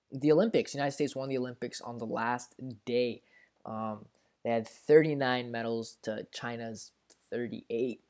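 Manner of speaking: usual speaking voice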